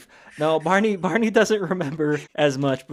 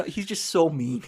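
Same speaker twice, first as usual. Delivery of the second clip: normal talking voice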